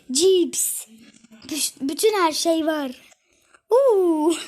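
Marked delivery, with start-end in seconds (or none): 3.7-4.4 a loud high ooh of delight; excited ooh. Real, not acted. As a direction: squeal an ooh over the snacks. Excited about snacks.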